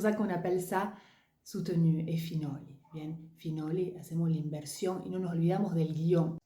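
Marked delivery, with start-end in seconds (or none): none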